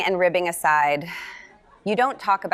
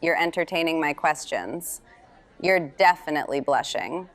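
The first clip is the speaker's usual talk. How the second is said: normal talking voice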